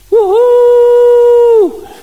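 long, high, loud woohoo; sustained piercing party whoop; celebratory whoop. Acted. Heaven celebrating when you came to know Jesus, whooping.

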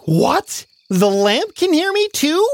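put-on high, startled child; surprised Nora. Nora amazed the lamp can hear her. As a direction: gasp it out in disbelief.

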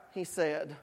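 usual speaking voice

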